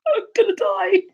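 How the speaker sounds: high, loud panicked cry; hammed-up fright, high and loud; mock terror. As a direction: squeal in mock terror of death. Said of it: "And I just went".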